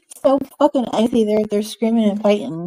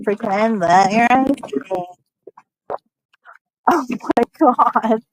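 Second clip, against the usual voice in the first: normal talking voice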